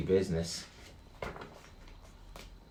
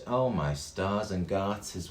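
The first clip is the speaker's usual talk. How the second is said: usual speaking voice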